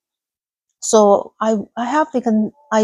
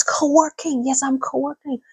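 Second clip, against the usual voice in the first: usual speaking voice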